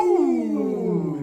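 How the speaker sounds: loud, high mock crowd "ooh". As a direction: echo the stadium's gasp. Crowd reaction imitated.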